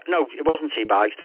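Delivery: ordinary delivery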